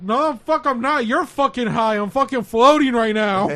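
insistent shout. Retold or imitated. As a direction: loudly protest like a stoned kid. High teller insisting he floats.

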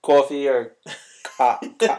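put-on botched English accent, loud; attempted English accent. Co-host failing at an accent for friends, bad English accent. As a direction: try and fumble an English accent. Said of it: "You can't do an English accent".

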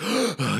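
mock gasp of someone surfacing; acted breathless gasp; gasp for air. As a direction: gulp air as if nearly drowned. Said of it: "he's just gasping for air".

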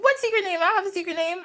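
high-pitched protest; mock innocent denial. Granddad denying his secret name to Isis, high mock innocence. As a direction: play dumb in a high, squeaky-clean voice.

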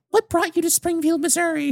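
high, put-on Boston accent. Waiter hamming a Boston accent for bored diners. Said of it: "doing the fucking Boston shtick".

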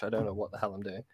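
ordinary talk, nothing marked

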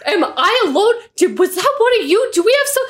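imitating a panicked player hit by a mystery arrow; mock panic. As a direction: play the spooked opponent, rising in alarm. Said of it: "he was panicking about it".

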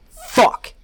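high, loud startled outburst; a sharp cry of fright at the screen; sudden curse out loud. A narrator's reading. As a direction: blurt it out in shock. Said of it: "I said out loud".

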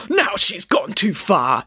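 indignant, drawn-out outburst; exploding in anger. A narrator's reading. Doctor fed up with Nanny Piggins, exploding. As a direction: burst out slowly in outrage. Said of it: "exploded the doctor".

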